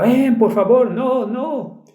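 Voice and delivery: retold high-pitched pleading cry; mother screaming. Mother begging from upstairs at night, retold scream. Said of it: "escuchas a la madre gritando".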